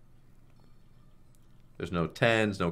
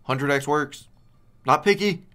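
louder and higher, cheering the spin on; excited shout; 7 semitones higher, 9 dB louder than usual. Real, not acted. Streamer cheering his slot spin, excited shout. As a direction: call out for the big win. Hoping for a big multiplier.

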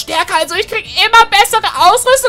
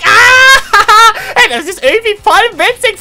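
giggling through the words, louder; laughing; 3 dB louder than usual. Acted. Boy laughs while being flown through the air, giggling. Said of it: "nicht lachen".

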